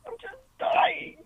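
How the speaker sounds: slow, sobbing words; real tears, slow and pleading; crying. Real, not acted. Caller sobbing about her loneliness.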